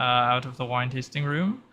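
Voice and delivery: ordinary talk, nothing marked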